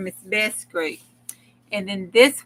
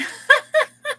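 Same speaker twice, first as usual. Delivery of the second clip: high laugh; 6 dB louder than usual. Real, not acted. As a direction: burst of high squealing laughter. Laughs at funny card.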